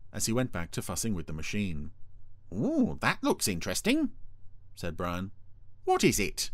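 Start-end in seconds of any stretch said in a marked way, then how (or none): 2.5-4.1 high-pitched, intrigued little voice; chirpy high character voice; curious Brian. A narrator's reading. 5.8-6.5 high, eager question; chirpy high character voice; curious Brian. A narrator's reading.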